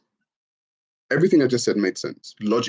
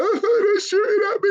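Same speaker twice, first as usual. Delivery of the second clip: much higher, whiny crybaby voice; mock crying whine. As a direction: whine like a victim, hammed-up. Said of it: "you start crying, like".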